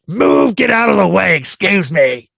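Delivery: slow, put-on demanding voice; mock yell of an angry stranger; yelling woman. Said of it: "like, yelling at me".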